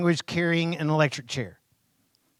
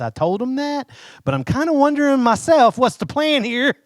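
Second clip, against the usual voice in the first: much higher, louder, exasperated question; anxious Moses; 9 semitones higher, 4 dB louder than usual. Retold or imitated. Preacher voicing Moses, anxious and exasperated, asking God for the plan. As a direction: let the worry burst out as you ask what the plan is. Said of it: "You heard these people?".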